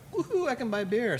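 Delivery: high-pitched retold whoop of joy; excited cheer. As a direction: cheer it like a kid let loose. Newlywed cheering about buying beer.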